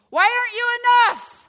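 high, loud plea shouted at God; re-enacted desperate shout; anguished cry. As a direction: cry out in frustrated prayer.

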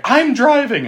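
high, loud urgent call; hurried declaration. Retold or imitated. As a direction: grab the wheel in a hurry. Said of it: "She's like".

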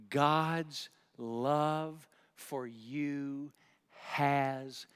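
drawn out very slow, each word weighed; slow emphatic repeat. Real, not acted. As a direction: let every word land slowly. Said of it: "I'm gonna say it again".

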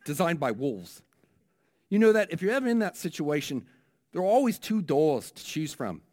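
a hammed-up New Zealand preacher selling the doors; New Zealand accent. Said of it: "I'm gonna try my New Zealand accent here".

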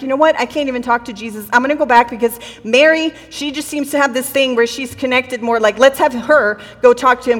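ordinary delivery

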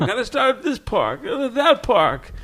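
high, rushed, tugging along; eager kid pulling you everywhere; being dragged. Retold or imitated. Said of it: "he was dragging me here".

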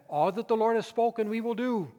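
loud, high declaration of the people; people's vow. Retold or imitated. Israel vowing to obey the Lord, loud and high. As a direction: call it out like a whole nation pledging. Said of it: "they said".

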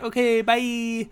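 high, slow, singsong brush-off; chirpy dismissal. Retold or imitated. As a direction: wave him away brightly. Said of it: "I took a cue from that".

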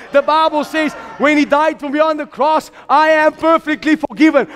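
high, driving declaration of faith; fervent preaching. Real, not acted. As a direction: proclaim it with rising fervour. Preaches at full force.

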